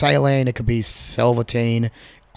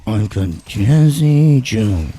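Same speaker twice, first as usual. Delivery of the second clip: sultry Jazzy Joe voice; 5 dB louder, much slower than usual. Pretend. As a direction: lay it on thick and smooth. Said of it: "he's got the sultry voice".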